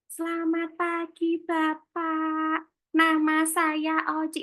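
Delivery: high, slow, drawn-out dainty demo; syllables stretched on one high level note; overly gentle voice. Shown as a demonstration. Timid candidate greeting an interviewer, exaggeratedly gentle. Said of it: "kalau suaranya terlalu lembut".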